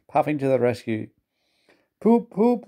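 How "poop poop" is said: very high imitated toots; engine whistle. A narrator's reading. Gordon whistles to Thomas on arrival, tooting. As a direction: toot like a train whistle. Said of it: "he whistled".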